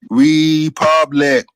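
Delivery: Republic said very slowly, each syllable weighted; drawn-out Republic. Acted. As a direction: stretch out Republic to make the point. Stressing the name's meaning.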